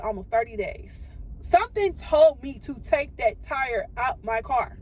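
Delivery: ordinary talk, nothing marked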